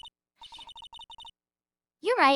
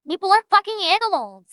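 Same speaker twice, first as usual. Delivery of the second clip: shouted, higher and louder; loud mock outrage; loud outburst; 5 semitones higher, 8 dB louder than usual. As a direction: blurt it out loud. Heated outburst in banter.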